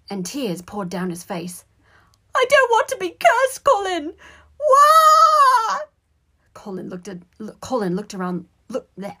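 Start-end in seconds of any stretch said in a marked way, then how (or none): 2.3-5.8 loud, childish mock bawling; high-pitched wail. A narrator's reading. Boy in tears begging his brother, high-pitched wail. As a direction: wail tearfully at the top of the voice. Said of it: "His high-pitched voice began to wail".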